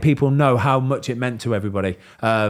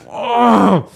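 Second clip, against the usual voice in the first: high, loud straining hug grunt; mock bear-hug groan; happy grunt of a hug; 11 semitones higher, 7 dB louder than usual. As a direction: squeeze out a joyful grunt. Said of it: "he's just like".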